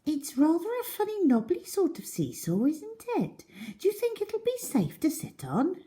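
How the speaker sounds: put-on high, doubtful girl's voice; older girl questioning the funny seesaw. A narrator's reading. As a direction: ask it warily, unsure. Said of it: "a girl who was older".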